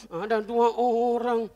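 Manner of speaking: straining, very slow winded answer; out of breath. Acted. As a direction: puff it out with effort, stretching the words. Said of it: "Ini tukang becak ngos-ngosan". (jawaban ngos-ngosan, diseret panjang)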